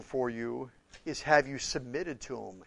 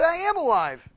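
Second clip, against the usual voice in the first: mock insistence; 14 semitones higher, 7 dB louder than usual. Insisting in acted exchange.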